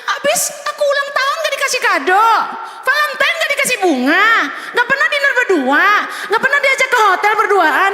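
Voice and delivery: high, giggling whiny complaint; complaining wife. Retold or imitated. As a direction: whine about the cold husband through laughter. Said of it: "Tapi kenapa masih komplain?". (istri mengeluh manja sambil tertawa, suara tinggi)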